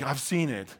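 usual speaking voice

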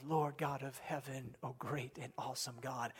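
low, soft voice of awe; hushed reverent prayer. Acted. Preacher voicing Nehemiah's belief about God, hushed reverent prayer. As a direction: address God quietly and reverently. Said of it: "starts with his belief about God".

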